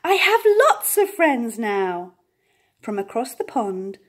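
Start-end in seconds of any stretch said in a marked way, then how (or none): 0.0-2.1 loud, slow, happy declaration. A narrator's reading. Little beaver shouting his happiness across the pond, loud. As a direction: shout it out for joy. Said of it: "very loudly".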